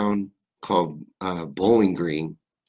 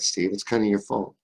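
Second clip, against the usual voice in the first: normal talking voice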